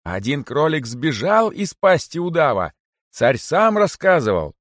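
high, loud proclamation; carrying shout. A narrator's reading. Cheeky rabbit keeps calling while dragged away, shouting. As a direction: proclaim it high, slowing toward the end. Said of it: "всё ещё доносился голос дерзкого кролика".

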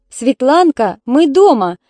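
loud call into the flat; loud homecoming call. A narrator's reading. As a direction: call out to the daughter from the doorway. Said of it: "крикнула она".

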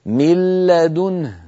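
slow recitation of "من لدنه", nun merged into a doubled lam; recited with idgham. Shown as a demonstration. Teacher showing the enlarged slide, reciting slowly with merged nun. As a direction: draw out "من لدنه" as "mil-ladunhu", no trace of the nun. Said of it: "فصار النطق بلام مشددة".

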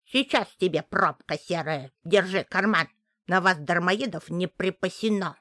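put-on scolding old witch voice; grumbling witch. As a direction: grumble at the freeloaders. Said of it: "Старуха из-за печи ругается".

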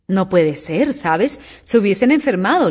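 ordinary delivery

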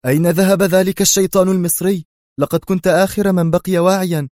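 indignant yell at partner; indignant shout. Acted. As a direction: demand it furiously. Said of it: "هتف به بيمبوليني في سخط".

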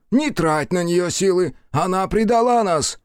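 Foya shouting that she betrayed them; angry shout. A narrator's reading. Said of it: "крикнул Фоя".

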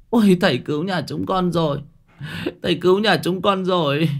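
high, emotional thanks; two women thanking the master on their knees; grateful cry. A narrator's reading. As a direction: cry it out in relief.

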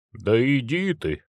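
slow, offended grumble; sulky grumble. Acted. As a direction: mutter it back, hurt pride. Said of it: "обиженно проскрежетал провинившийся".

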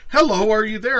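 high, loud mock greeting; loud mimicry. Mimicking what she typed on screen, loud mock call.

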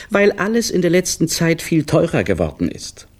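high, quick reproachful voice; reproachful wife. A narrator's reading. Wife reproaches her husband over lunch excuses, high and quick. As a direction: throw his own words back at him.

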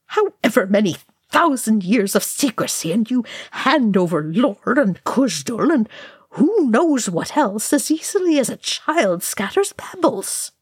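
high-pitched, swinging character outburst; indignant rant. Acted. Dwarf protesting secrets handed over, indignant rant.

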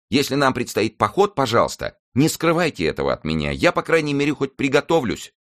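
high, pleading servant's voice; exclaiming servant. A narrator's reading. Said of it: "воскликнул Мушкетон". (высокий голос встревоженного слуги)

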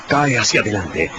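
ordinary talk

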